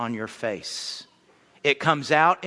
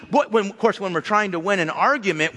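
normal talking voice

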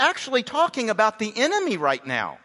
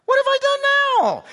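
much higher, louder whine on "What have I done now?"; whiny little brother; 6 dB louder, faster than usual. Acted. Preacher voicing young David whining at his big brother. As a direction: whine it like a put-upon kid brother. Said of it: "Doesn't it sound like a little brother?".

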